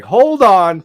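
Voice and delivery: imitating a startled friend; startled Joe. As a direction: back off in mock alarm. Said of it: "Joe's like".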